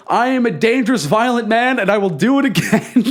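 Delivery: high, hammed-up proclamation breaking into laughter; mocking declaration. Storyteller ridiculing the violent performer's speech, mockingly grandiose.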